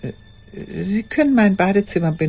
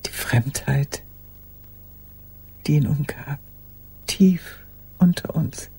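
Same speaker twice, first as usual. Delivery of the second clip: whisper. Acted. Recalling his strangeness.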